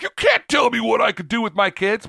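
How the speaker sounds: hammed-up indignation, pushed high and loud; indignant American. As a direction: act out a defiant American parent. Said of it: "in America, we'd be like".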